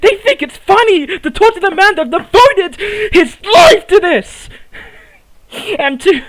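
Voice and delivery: choked-up, crying while he complains; tearful rant. Acted. A hurt wrestler pleads to the crowd, voice cracking. Wrestler's emotional promo about van.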